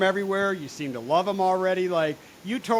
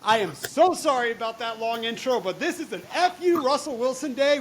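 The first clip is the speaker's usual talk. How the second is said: ordinary talk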